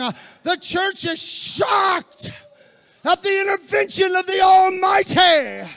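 loud, high shouted sermon build; fiery preaching. Acted. Preacher shouting to his congregation, fiery and loud. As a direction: preach it to the rafters, pounding each phrase.

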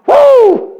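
high, loud "woo!"; preacher's sudden loud whoop; excited whoop. Real, not acted. Excited whoop after the verse.

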